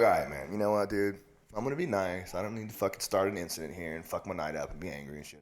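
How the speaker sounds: low, soft, restrained patience; subdued self-calming talk; playing nice. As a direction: hold it back, quietly reasonable. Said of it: "You're gonna get two nice Kevins".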